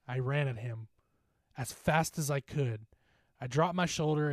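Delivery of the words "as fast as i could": plain reading voice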